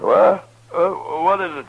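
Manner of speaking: jolted out of sleep; startled awake. Acted.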